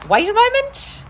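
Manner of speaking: high, loud wait a moment; sudden call out. Real, not acted. Host calls out, high and loud. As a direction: call out to stop the stream. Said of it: "I'm trying not to yell".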